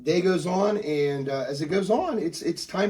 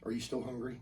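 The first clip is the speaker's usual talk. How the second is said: very soft, tender question; hushed motherly care; gentle mom; 3 semitones lower, 13 dB quieter than usual. Acted. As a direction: check on a hurt child quietly. Mom gently checking on upset son.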